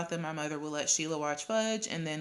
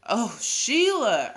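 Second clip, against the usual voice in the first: loud, drawn-out exasperation; exasperated shout; 6 semitones higher, 8 dB louder, much slower than usual. A narrator's reading. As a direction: shout her name in annoyance, higher and slower. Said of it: "I shouted".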